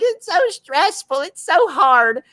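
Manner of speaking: high, slow whining mockery of a pampered YouTuber; mocking spoiled YouTuber, high and drawn out; whiny mock YouTuber. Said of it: "And, like".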